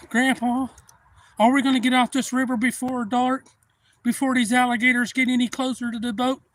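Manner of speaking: put-on high, loud child's plea; worried grandson. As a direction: whine up at grandpa. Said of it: "His grandson".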